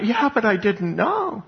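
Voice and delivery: high, slow, pleading excuse; whiny excuse. Acted. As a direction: whine it out slowly, caught out. Damned man's excuse.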